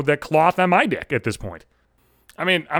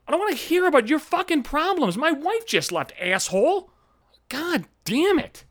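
high-pitched, exasperated retort to mom; exasperated outburst; 10 semitones higher than usual. Retold or imitated. Son fed up with mom venting about dad, exasperated. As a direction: snap back, pitched way up, dragging out the curse. Acted outburst at mom's complaints.

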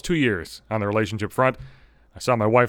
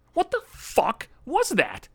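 slow, high, strained disbelief; stunned and exasperated; incredulous; 10 semitones higher, much slower than usual. Real, not acted. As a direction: drag it out, stunned. Outraged at her reply.

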